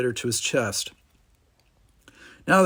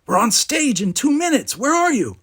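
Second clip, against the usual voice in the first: ordinary delivery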